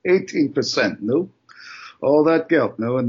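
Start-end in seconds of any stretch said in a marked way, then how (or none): 0.1-1.3 said through a chuckle; laughing. Acted. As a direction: chuckle in disbelief as you say it. Scoffs at the bigger payout offer.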